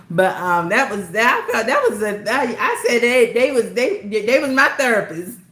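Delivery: giggling while admitting the live was her therapy; laughing. Real, not acted. To her viewers, joking that the live was her therapist, laughing.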